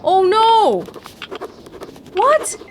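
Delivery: high dismayed cry. Real, not acted. Dismayed at cancelled shipment.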